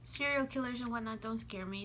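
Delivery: normal talking voice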